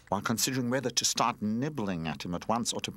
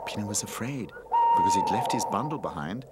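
ordinary delivery

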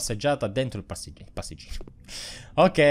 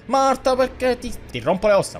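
shouting a hammed-up threat at Marta the ghost; mock threat; 11 semitones higher than usual. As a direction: yell a hammy threat at the ghost.

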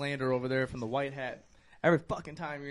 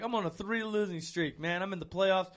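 mock cocky; 4 semitones higher, 7 dB louder than usual. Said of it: "That he's like".

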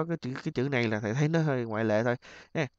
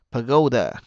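Pagoda with GO punched louder; stress on second syllable; 5 dB louder than usual. Shown as a demonstration. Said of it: "nhấn âm hai nè". (nhấn âm hai Pagoda)

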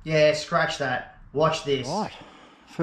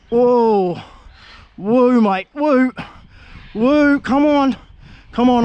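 rising, louder whoops while fighting the fish; real thrill, whooping higher and higher; excited whoops; 4 semitones higher, 3 dB louder, slower than usual. Real, not acted. Angler whooping as the fish fights, excited.

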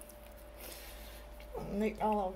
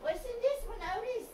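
high pet-talk voice; 10 semitones higher, 8 dB quieter, faster than usual. Real, not acted. Searching, talking to dog Otis.

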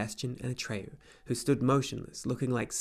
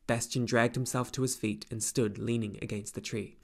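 usual speaking voice

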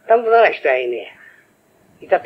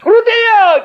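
excited shout; 7 dB louder, much slower than usual. Acted. Cheering himself on.

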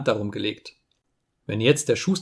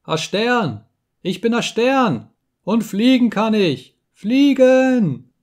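put-on higher and higher, louder, rejoicing child; a boy cheering as he flies; child exulting, building to a high cry; jubilant; 10 semitones higher, 5 dB louder, slower than usual. A narrator's reading. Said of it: "flog ihnen voran und jubelte".

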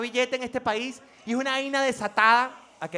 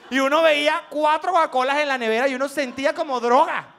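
ordinary talk, nothing marked

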